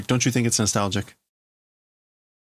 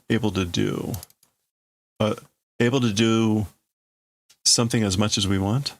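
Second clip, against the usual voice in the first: ordinary talk, nothing marked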